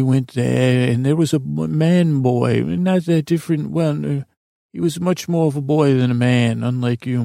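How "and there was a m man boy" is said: ordinary delivery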